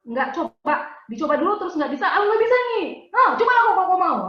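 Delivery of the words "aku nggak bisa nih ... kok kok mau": higher, louder mock bickering; whiny quarrel voice. Wife mimicking a quarrel with husband, whiny. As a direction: whine it like a spat with a husband. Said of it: "udah kayak gitu tuh, ngomongnya gitu".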